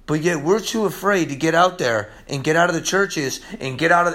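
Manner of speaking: normal talking voice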